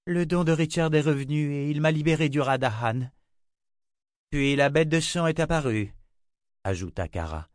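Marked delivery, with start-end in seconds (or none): 0.1-5.9 higher, firm woman's report; bodyguard voice. A narrator's reading. Bodyguard tells the prophet the news, higher firm voice. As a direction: report it crisp and proud.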